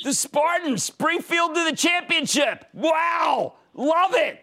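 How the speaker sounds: high, drawn-out excited hollering; excited cheering. Real, not acted. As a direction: cheer like a thrilled hometown fan. Cheers caller's home team.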